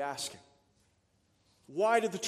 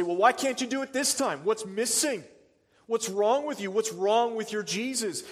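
scribes taunting; 6 semitones higher, 8 dB louder than usual. Retold or imitated. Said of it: "And the scribes say".

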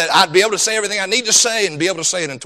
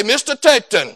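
imitated thick Jamaican accent; 3 semitones higher than usual. Soccer coach calling to a player at practice, imitated Jamaican accent. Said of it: "he had that thick Jamaican accent".

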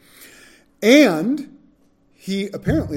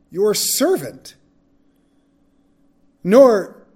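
measured, formal Bible reading with a raised voice; scripture reading; 3 semitones higher, 5 dB louder than usual. Acted. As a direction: read the passage to the class, steady and solemn. Reads scripture aloud.

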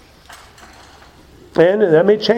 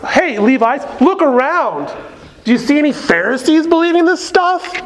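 higher, mocking Pharisee taunt; arrogant mock; 7 semitones higher than usual. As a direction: talk down to them, lightly arrogant. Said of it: "Sort of arrogant".

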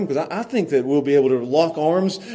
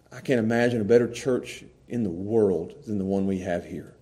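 soft, low prayer voice; closing prayer; 5 semitones lower, 4 dB quieter than usual. Acted. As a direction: pray quietly and humbly.

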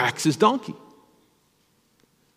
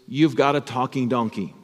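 normal talking voice

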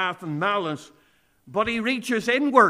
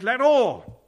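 "all" punched higher and louder; stress on all; 4 semitones higher, 6 dB louder than usual. Shown as a demonstration. Pastor to congregation, hammering "all" with stress. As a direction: lean hard on "all". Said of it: "we've emphasized the word all".

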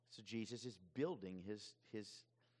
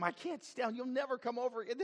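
high, loud, fast mock-child outburst; child's tantrum; 14 semitones higher, 7 dB louder than usual. Children falling out with a friend, hammed-up high outrage. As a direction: rattle it off like a furious child, pitched way up. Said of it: "They go from".

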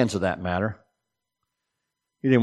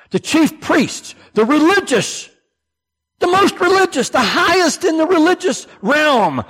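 rising emphatic build; 4 dB louder than usual. Acted. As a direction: climb higher with each title of the priests. Building emphasis on religious leaders.

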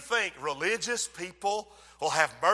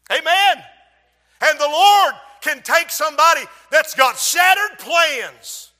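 louder, higher pulpit cry; full-force preaching; 7 semitones higher, 8 dB louder than usual. Real, not acted. Preacher proclaiming what the Lord can do, full-force preaching. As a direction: preach it out to the whole church.

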